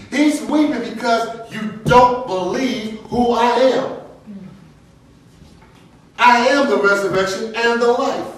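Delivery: high, loud, forceful sermon voice; impassioned preaching. Real, not acted. Correcting those who misread the weeping, impassioned preaching. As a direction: drive the point home at full force.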